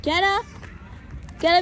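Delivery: loud high call. Real, not acted. Calling out to her son. (ज़ोर से बच्चे को पुकारना)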